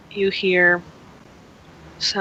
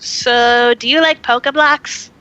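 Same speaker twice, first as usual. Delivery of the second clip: high, bright and eager, almost squealing; eager cute voice; 5 semitones higher, 4 dB louder than usual. Acted. Little Pokemon eagerly asks Moz about treats, high squeaky. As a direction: ask it like an excited little creature.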